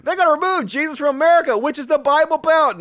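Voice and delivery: fast, high, loud mimicry; hammed-up high-pitched mock alarm; mocking parrot. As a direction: throw the words back in ridicule. Mockingly repeats the clip's words.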